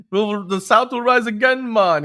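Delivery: put-on loud, high, slow failed Southern accent; hammed-up Southern accent drifting toward Caribbean; botched Southern accent. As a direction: attempt a Southern accent and miss badly. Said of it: "doing a Southern accent".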